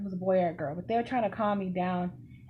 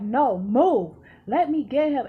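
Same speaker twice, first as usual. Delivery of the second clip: loud, higher, forceful order; retold angry demand; 5 semitones higher, 7 dB louder, slower than usual. Student pushing past the teacher to fight, loud angry demand.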